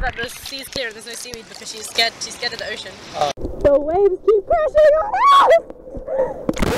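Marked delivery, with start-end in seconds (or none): 3.6-6.7 loud, high cry over the waves; excited shout. Real, not acted. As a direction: yell as the wave hits. Waves hit them in sea.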